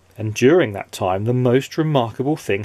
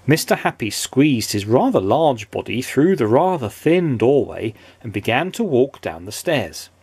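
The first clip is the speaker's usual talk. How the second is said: plain reading voice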